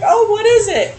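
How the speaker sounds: put-on high, eager old lady voice; Granny's question, squeaked up high in an old lady voice. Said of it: "But I wanted you to do her voice".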